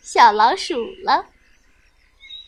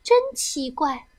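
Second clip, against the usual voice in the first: ordinary delivery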